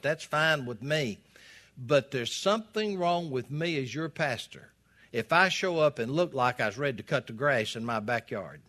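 ordinary delivery

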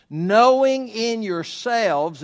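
slow, high and loud, each word leaned on; drawn-out emphatic quote. Acted. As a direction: stretch out "Knowing in yourselves" like a proclamation. Preacher stresses scripture phrase.

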